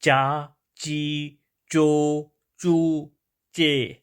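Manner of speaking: "چا، چی، چو، چو، چے" slowly, each vowel held; syllable drill with ch. Shown as a demonstration. Teacher shows learners the sound, drilling "چا، چی، چو، چو، چے". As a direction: sound out "چا، چی، چو، چو، چے" one by one. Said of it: "تو آج اس کے بارے میں آپ کو بتا رہا ہوں".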